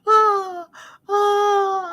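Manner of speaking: high laugh-cry oh. Real, not acted. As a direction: squeal through laughter. Laughing at panda joke.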